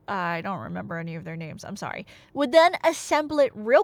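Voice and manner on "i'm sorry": ordinary delivery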